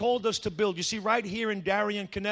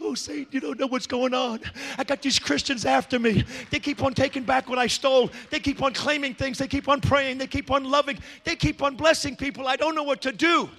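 harried demons; 4 semitones higher than usual. Acted. Demons running to Satan about Christians, mock-frantic. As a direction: mock-desperate complaint, piling up. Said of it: "demons running to Satan saying".

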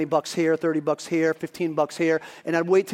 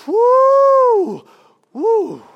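high, loud "woo" whoop; excited whoop; 8 dB louder than usual. Real, not acted. Preacher punctuating his tithe message, whooping high. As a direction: let out a long whoop, then a short one.

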